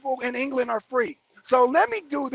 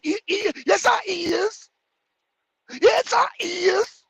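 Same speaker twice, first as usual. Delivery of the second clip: high, slow mock; 7 semitones higher, slower than usual. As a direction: sing out the error mockingly.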